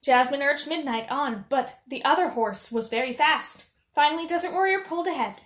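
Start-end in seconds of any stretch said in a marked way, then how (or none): none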